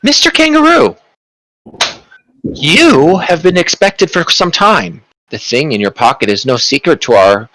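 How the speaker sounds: a sweet, knowing doorkeeper; door lady voice. Acted.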